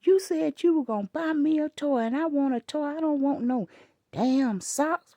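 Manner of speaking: put-on sass it like a spoiled little kid; bratty child. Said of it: "that child said".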